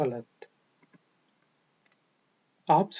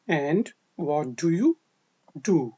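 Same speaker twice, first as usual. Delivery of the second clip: slow model question; much slower than usual. Shown as a demonstration. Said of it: "slow model question".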